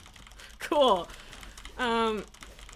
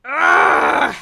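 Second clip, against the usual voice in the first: frustrated groan; 13 dB louder than usual. Real, not acted. Failed the mechanic, frustrated.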